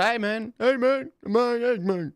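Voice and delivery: mocking the fighter with a squeaky whine; Nate Diaz impression. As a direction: do a goofy high-pitched Nate Diaz. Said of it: "That's what".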